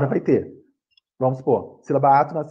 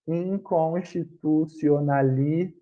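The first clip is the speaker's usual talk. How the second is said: "Inconstitucionalmente" drawn out very slowly; slow, stretched dictation of "Inconstitucionalmente"; syllable by syllable; 3 dB quieter, much slower than usual. Shown as a demonstration. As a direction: sound out every syllable of "Inconstitucionalmente". Teacher sounds out giant example word.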